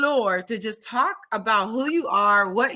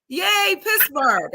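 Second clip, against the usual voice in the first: high, drawn-out cheer; stretched-out, high-pitched hooray; retold cheer; 8 semitones higher, much slower than usual. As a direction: imitate a stranger cheering from across the room. Retelling a stranger's cheer.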